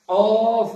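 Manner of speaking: অফ hit loud from the back of the tongue; loud back-of-throat অফ; deep k demo. Shown as a demonstration. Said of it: "আমার সঙ্গে সঙ্গে বলার উচ্চারণ চেষ্টা করুন".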